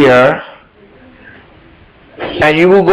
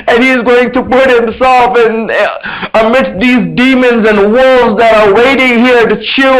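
higher, tearful voice of a broken, ashamed man; 6 semitones higher than usual. Acted. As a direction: let the shame crack through as you speak for him.

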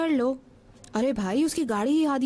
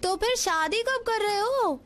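high, drawn-out pestering question; slow, high-pitched nagging; persistent nagging; 6 semitones higher, slower than usual. Acted. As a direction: stretch it out higher, refusing to drop it. Repeats the question after being shushed.

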